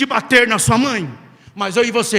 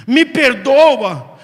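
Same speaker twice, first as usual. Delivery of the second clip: "me perdoa" very slow and loud; the sinner begging God, "me perdoa" drawn out; drawn-out plea; 7 dB louder, much slower than usual. Shown as a demonstration. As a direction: stretch "me perdoa" long and loud. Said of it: "quando você diz".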